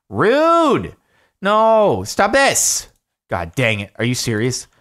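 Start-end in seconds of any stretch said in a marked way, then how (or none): 0.1-2.9 high, loud cries of protest; loud, high-pitched exasperation; indignant shout. Real, not acted. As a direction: yell at the game in outrage. Protesting as the game thwarts them.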